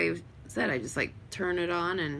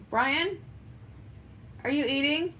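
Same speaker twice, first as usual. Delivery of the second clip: higher, questioning call to another room; 7 semitones higher than usual. Real, not acted. Checking on Brian eating, calling out. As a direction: pitch it up to reach someone off-mic.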